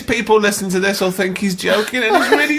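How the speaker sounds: very high, loud through laughter; helplessly amused protest; laughing. Real, not acted. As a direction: squeal it out, amused. Amused protest over shared laughter.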